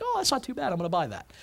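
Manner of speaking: hint of an easily tempted shopper; offhand, quietly talking yourself into it; casual shopper. Voicing shoppers in checkout line.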